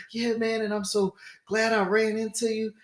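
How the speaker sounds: lightly voiced overfriendly rich guy; gushing stranger. Stranger chatting him up.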